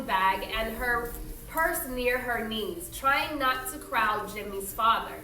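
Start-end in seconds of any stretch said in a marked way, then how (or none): none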